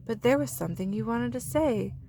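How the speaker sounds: ordinary delivery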